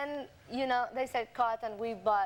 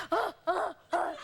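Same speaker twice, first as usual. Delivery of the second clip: imitated gasping; 5 semitones higher than usual. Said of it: "And he goes".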